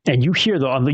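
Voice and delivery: ordinary delivery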